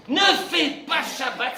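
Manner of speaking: slow, loud preaching shout; shouted command. Real, not acted. Rabbi scolding short-tempered believers, slow loud shout. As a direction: bark it out slowly.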